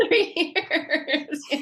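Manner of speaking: slow, drawn out through laughter; laughing. Real, not acted. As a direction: break into a laugh mid-phrase. Joking about buying only tops.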